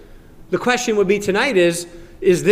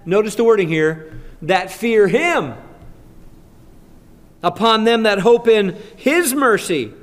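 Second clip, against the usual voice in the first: scripture reading; 6 semitones higher, 3 dB louder than usual. Acted. Pastor proclaims Psalm 33 to the church, higher and louder. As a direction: proclaim the verse with weight.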